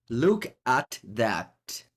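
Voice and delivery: "Look at that" drawn out word by word; very slow, spaced-out "Look... at... that"; slow emphatic repeat. Real, not acted. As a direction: savour each word of "Look at that".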